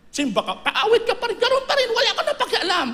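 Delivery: high, laughing mockery of a careless believer; mocking. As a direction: scoff at the hypocrite churchgoer. Preacher mocks careless believer. (pakutyang tawa sa mapagkunwaring nagsisimba)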